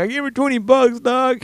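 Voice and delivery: high-pitched mock indignation; indignant protest. Customer telling fellow tipper what he paid, indignant. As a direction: let the voice leap up in protest.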